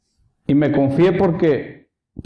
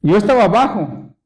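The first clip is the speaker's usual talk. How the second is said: ordinary delivery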